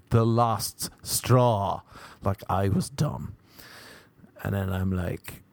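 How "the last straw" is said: very slow, emphatic mock-angry delivery; angry repetition. Girl repeating herself to the comic, angry and slow. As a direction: spell it out slowly, mildly cross. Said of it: "got angry and she said".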